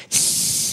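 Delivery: long sharp "tss" of spraying water; hissing sound effect through the teeth; imitated hiss. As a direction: mimic a jet escaping. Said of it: "started going".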